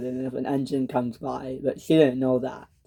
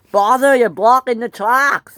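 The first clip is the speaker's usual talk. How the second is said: loud, raised-voice complaint; mad; 7 semitones higher, 7 dB louder than usual. A narrator's reading. Percy to engines blocking his way, mad. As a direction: yell at them to clear off. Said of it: "He was mad".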